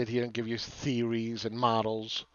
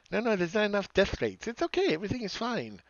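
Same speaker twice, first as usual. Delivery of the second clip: mocking; 9 semitones higher than usual. Mocking bullish traders' dismissal.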